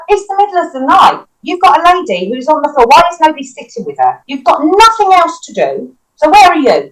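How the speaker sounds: indignant scolding. Retold or imitated. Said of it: "So my back's up".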